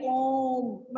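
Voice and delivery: ओम stretched long and slow; drawn-out om. Shown as a demonstration. Teacher shows a class how ओम is stretched, drawn out slowly. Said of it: "थोड़ा लंबा करके बोलते हैं".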